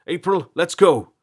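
loud, high shout to move; urgent call. A narrator's reading. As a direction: call out to hurry her away. Calling her to flee.

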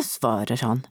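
ordinary delivery

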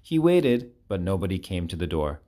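ordinary delivery

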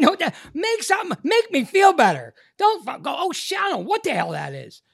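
loud, high comic outrage; exasperated rant. Retold or imitated. Patient demanding doctor make him feel better, exasperated. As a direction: rant at full pitch, pleading and indignant.

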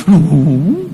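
drawn-out "no" sliding upward; child's denial. Retold or imitated. Son denies despite father's threats, stubborn mock-innocent denial. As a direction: stubbornly play innocent like a small boy.

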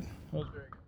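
whispered, no voice; whispered take. Acted. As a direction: breathe it as a secret.

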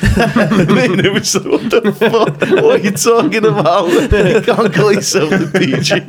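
laughing at the teacher's band name; laughing incredulous retort. Retold or imitated. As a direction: scoff in disbelief while laughing. Laughing retelling own dream reply.

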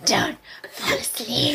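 slow, eerie whisper; voiceless, drawn-out mock menace; creepy whisper. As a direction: whisper it as a spooky warning. Spooky warning about the beastie.

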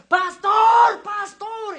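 high, loud, drawn-out shout; urgent calling out. Retold or imitated. Father runs after the pastor in hospital, urgent calling. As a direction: call after someone down a corridor.

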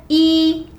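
"i" held high and level; first tone. Shown as a demonstration. Teacher models the number one for the hosts, first tone. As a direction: hold "i" on one steady high pitch. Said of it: "Bien, primer tono".

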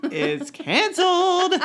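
high, loud, slow drawn-out verdict with a laugh; mock proclamation. Wife declaring her husband's favorite show doomed, mock shout. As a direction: pronounce doom on the show, hammed up. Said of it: "and I'd be like".

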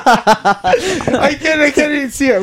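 high breaking laughter; laughing. Real, not acted. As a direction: crack up mid-sentence. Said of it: "This is sounding so weird".

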